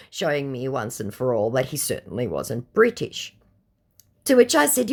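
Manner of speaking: usual speaking voice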